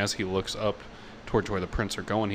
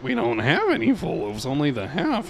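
barkeep voice; 8 semitones higher, 7 dB louder than usual. Acted.

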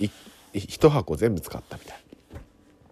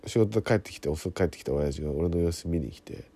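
normal talking voice

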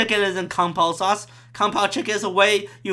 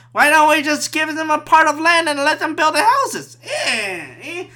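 higher, put-on old-timey boss voice; mock Victorian; 6 semitones higher than usual. Victorian boss planning railway work, old-timey put-on voice. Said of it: "it's a Victorian".

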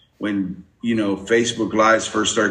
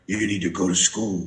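lightly voiced Batman, low and soft; a hint of Batman talking to kids; 3 semitones lower, 5 dB quieter than usual. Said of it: "come out as Batman".

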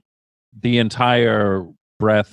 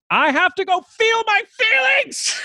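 put-on much higher, shouted little-kid voice; four-year-old son. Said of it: "he'll run out of the room and just be like".

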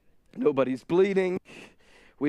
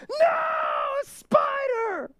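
put-on high, drawn-out child's shriek; little girl screaming; much slower than usual. Daughter Glory in the back seat, shrieking high and slow. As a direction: scream it like a terrified small girl.

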